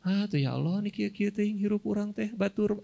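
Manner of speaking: complaining whine. Retold or imitated. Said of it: "Misal gimana?".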